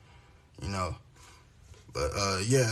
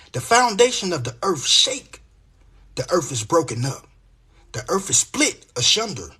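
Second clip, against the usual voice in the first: measured, rhythmic Bible reading, a little louder; scripture reading; 3 semitones higher, 5 dB louder than usual. Acted. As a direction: read the verses like a preacher. Reads Isaiah aloud.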